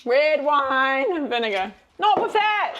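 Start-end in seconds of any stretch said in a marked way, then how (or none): 2.0-2.8 high, loud call to stop; sharp shout. Real, not acted. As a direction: cry out to stop her. Child smushing food, sharp stop.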